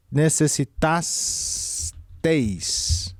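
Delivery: necessitasteis stretched out very slowly, syllable by syllable; slow dictation. Shown as a demonstration. Teacher writing the word, dragging it out slowly. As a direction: draw out necessitasteis as you write it. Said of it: "Vai ser aqui".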